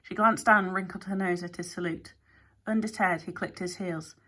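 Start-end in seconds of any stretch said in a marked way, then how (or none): none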